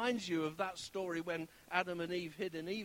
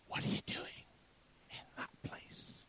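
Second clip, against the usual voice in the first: God's whisper; 3 dB quieter than usual. Retold or imitated. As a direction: whisper it as God, unhurried. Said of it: "God says".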